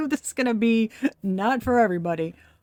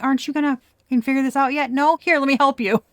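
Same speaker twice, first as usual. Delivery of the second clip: higher, fast, exasperated mock shove; hammed-up impatient spirit voice; the universe losing patience, teasing; impatient push; 5 semitones higher, 5 dB louder, faster than usual. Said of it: "It was like".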